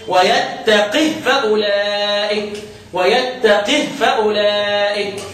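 ترتيل بطيء مع تسكين هاء "ويتقه"; كسر القاف والوقف بالسكون على هاء "ويتقه"; هاء ساكنة. Shown as a demonstration. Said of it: "من اللي سكن حمى صفوه". (اقرأ "ويتقه" بهاء ساكنة، على مهل)

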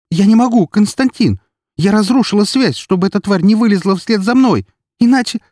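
very high, loud, desperate pleading; frantic high-pitched explanation; panicked protest. A narrator's reading.

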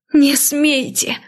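drawn-out, high shout of refusal; slow, high, desperate cry; roar. A narrator's reading. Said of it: "Взревела". (протяжный, высокий крик «Не смейте!»)

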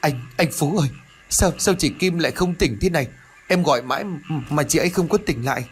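distraught voice. A narrator's reading. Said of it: "bằng một chất giọng thất thần".

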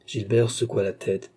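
ordinary delivery

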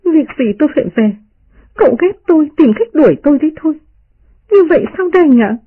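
high, fast, hurt and on the verge of tears; tearful protest. Acted. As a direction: plead through tears, voice pitched high. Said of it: "liền rơm rớm nước mắt".

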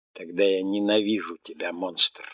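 plain reading voice

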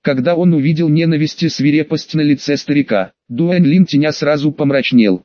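ordinary delivery

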